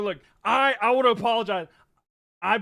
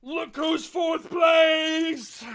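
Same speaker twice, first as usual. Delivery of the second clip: high, very slow, stretched-out cheer; ecstatic celebration; 7 semitones higher, much slower than usual. Real, not acted. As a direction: drag every word out in triumph. Celebrating team's rise in standings.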